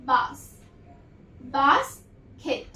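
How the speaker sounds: Basket said slowly, each sound held apart; slow blended demo word; drawn out slowly. Shown as a demonstration. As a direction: stretch basket out for the class. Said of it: "तो क्या sound देता है".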